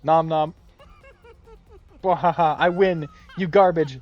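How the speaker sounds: loud, high hammed-up outbursts; booming rant. President tossing out insults, loud and high. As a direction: bellow every word like a cartoon tyrant.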